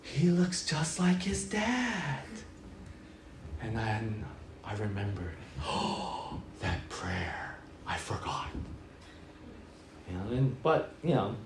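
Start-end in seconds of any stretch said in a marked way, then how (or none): 0.0-2.2 higher, slow gushing admirer; churchgoer admiring baby. Retold or imitated. Churchgoer cooing over the baby, slow and gushing. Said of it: "first person who goes". 5.6-8.5 a hushed oh-no to yourself; whispered realization. Retold or imitated. Pastor realizing his forgotten prayer, whispered.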